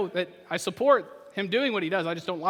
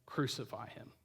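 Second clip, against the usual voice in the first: hushed crowd echo; 6 semitones lower, 10 dB quieter than usual. Retold or imitated. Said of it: "going along with the crowd and saying".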